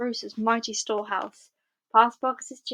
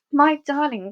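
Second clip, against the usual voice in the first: louder, higher happy cry; joyful cry; 5 semitones higher, 7 dB louder than usual. A narrator's reading. Missus Fox greets her returning husband with a joyful cry. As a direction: greet him with delight. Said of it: "cried Missus Fox".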